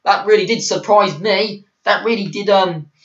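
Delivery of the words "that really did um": ordinary talk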